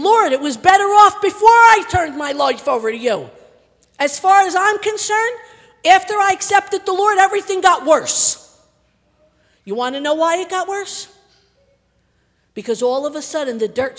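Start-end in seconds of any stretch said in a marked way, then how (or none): 0.0-8.5 raised, insistent acted protest; questioning the Lord. Struggling believer questioning the Lord, acted protest. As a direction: voice a struggling believer's loud complaint. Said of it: "You might be questioning".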